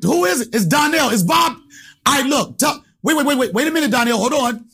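pushy cocky voice pitched high. Retold or imitated. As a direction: bluff hard like a big shot. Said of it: "I'm gonna act like cocky".

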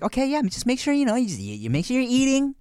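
a hint of a fussing doctor; doctor telling a pregnant mother to eat; imitating the doctor. Doctor quoted.

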